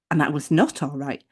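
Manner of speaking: ordinary talk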